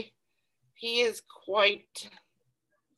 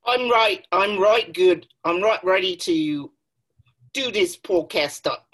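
loud, hammed-up mock British "I'm right ready"; mock British accent; 4 dB louder than usual. Podcast host kicking off the show in mock cockney accent. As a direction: ham up a cockney-ish accent, good and loud. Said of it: "we decided to have an accent today".

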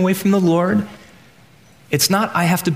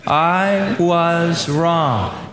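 very slow, each word spelled out together; slow group recital; 2 semitones higher, much slower than usual. Shown as a demonstration. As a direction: lead the room through it slowly. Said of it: "Let's just say it together".

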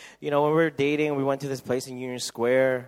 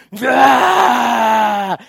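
high, loud triumphant roar; action-hero roar; 10 semitones higher, 16 dB louder than usual. Retold or imitated. Wolverine bursting from the tank, loud roar.

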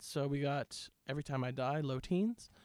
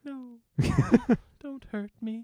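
mock pleading; 10 semitones higher, 8 dB louder than usual. Threatened with a bat.

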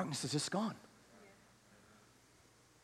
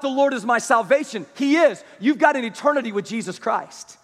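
higher, louder fervent preaching to the congregation; impassioned preaching; 11 semitones higher, 12 dB louder than usual. Acted. Preacher pressing the church, louder and higher, fervent. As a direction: preach it out, full force, pleading.

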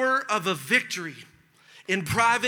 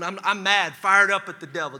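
ordinary talk, nothing marked

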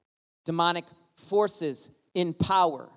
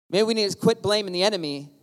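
normal talking voice

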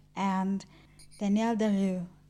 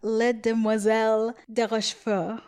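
the French title said with a careful French accent, a bit louder; 5 dB louder than usual. Shown as a demonstration. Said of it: "not a horrible French accent".

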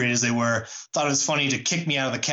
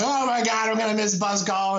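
put-on high, panicky whimper of a stranded drunk; mock blubbering; 9 semitones higher than usual. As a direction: whine it out like you're about to be abandoned. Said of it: "blubber to him".

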